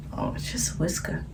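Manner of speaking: soft whispered aside; hushed whisper. Acted. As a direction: whisper it to yourself.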